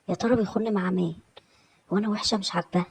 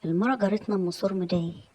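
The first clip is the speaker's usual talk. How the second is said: ordinary delivery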